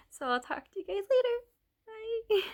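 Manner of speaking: high, fast, giggly goodbye; bright sign-off. Real, not acted. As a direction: chirp a cheery goodbye. Cheerful sign-off after laugh.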